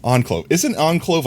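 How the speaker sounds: ordinary delivery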